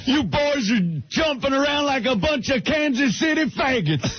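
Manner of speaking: high, yelled cowboy foreman line; Slim Pickens impression. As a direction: bark it like a Western boss. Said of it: "Slim Pickens".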